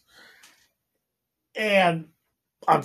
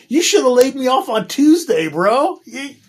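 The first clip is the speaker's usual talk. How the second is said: higher, heated comeback at the boss; retold angry retort; 9 semitones higher, 4 dB louder than usual. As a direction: replay the anger at being laid off. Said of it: "That's why I was fucking pissed".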